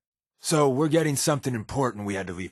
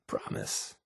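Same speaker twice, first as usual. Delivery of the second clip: breathy whispered promise; teasing whisper to a companion; 10 dB quieter than usual. Acted. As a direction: whisper it with a wink.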